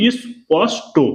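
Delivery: "ইস পস টো" in separate, spaced syllables; demonstrated pronunciation. Shown as a demonstration. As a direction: model "ইস পস টো" piece by piece, ending on an o sound. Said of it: "তাহলে কি হলো উচ্চারণ".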